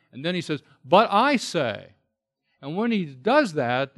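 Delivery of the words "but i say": drawn-out, forceful stress on I; emphatic I. Retold or imitated. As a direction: stress on I, loud and slow. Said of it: "it's an emphatic".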